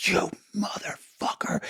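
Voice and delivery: breathy whispered curse, slow; hissed curse. Retold or imitated. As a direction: spit it out under your breath. Eric jumps up, furious.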